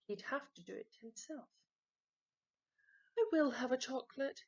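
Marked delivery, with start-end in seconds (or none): 3.2-4.4 louder, fast declaration; loud announcement. A narrator's reading. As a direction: announce it loudly so all hear. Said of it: "announced Peter loudly".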